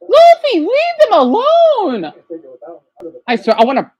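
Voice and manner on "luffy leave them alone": high, loud, stretched-out shout; exasperated yell. Real, not acted. Viewer telling Luffy off for bothering a family, exasperated yell. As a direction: holler it up high, dragging it out. Yelling at streamer on screen.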